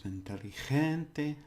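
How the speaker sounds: "Inteligente" slow and high, syllable by syllable; every syllable sounded. Shown as a demonstration. Teacher showing do your syllables, every syllable sounded. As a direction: spell out each syllable of "Inteligente", stressing "gen". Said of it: "always do your syllables".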